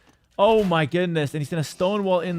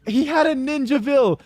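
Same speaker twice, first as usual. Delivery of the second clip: stunned hype; 6 semitones higher than usual. Real, not acted. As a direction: still reeling from the play. Caster still reeling, laughing.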